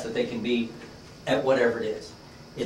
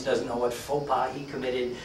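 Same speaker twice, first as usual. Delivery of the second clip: ordinary delivery, nothing marked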